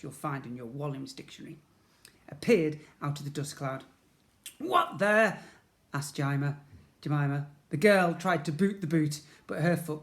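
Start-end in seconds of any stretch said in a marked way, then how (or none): 4.6-5.4 startled. Acted. Jemima startled by boot.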